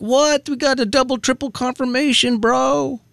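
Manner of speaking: high-pitched, mock-incredulous comeback. Cohost imitating the producer's reply to business people, incredulous.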